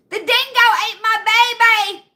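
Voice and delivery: a half-hearted Meryl Streep Australian line, high and loud; a quick botched Australian impression; Australian accent. Podcaster tosses off a Meryl Streep dingo line, in a bad Australian accent. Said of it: "didn't even sound Australian".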